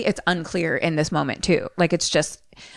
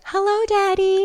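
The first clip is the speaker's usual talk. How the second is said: put-on high, small child's voice; little girl greeting her dad, much higher. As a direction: sound like a tiny ghostly girl. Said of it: "we hear Carol Ann be like".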